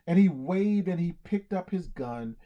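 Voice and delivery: plain reading voice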